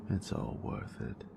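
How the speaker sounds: soft, close, teasing whisper to a lover; intimate whisper. Acted. Teasing her nerd with lights, close intimate whisper. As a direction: whisper it close, possessive and playful.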